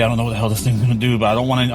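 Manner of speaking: ordinary talk, nothing marked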